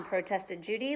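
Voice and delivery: ordinary delivery, nothing marked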